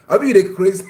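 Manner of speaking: hammed-up angry scolding; harsh insult. Shown as a demonstration. Husband insulting his wife, loud mock yell. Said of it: "it's not like".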